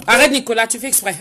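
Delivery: firm mum warning; scolding. Real, not acted. Mother scolding her child.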